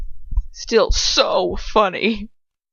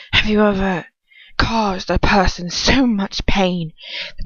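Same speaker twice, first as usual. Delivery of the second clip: menacing villain voice; 2 semitones higher, 4 dB louder than usual. Acted. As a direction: press him like a cruel dark lord. Villain interrogates narrator.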